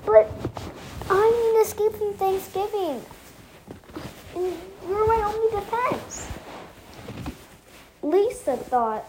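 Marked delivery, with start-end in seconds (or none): 0.0-6.3 sad pleading. Acted. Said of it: "got really sad and said".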